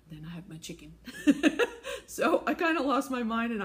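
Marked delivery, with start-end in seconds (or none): 1.0-2.0 bright burst of laughter; laughing. Real, not acted. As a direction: laugh at your own silliness. Laughing at the pom-poms.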